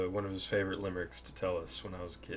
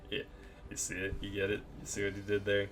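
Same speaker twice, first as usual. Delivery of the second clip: usual speaking voice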